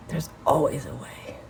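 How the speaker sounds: breathy whispered resolve. Real, not acted. Telling viewers she will find a way, whispered. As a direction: whisper it, just to yourself.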